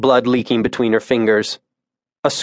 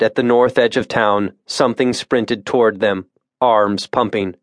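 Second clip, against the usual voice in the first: ordinary delivery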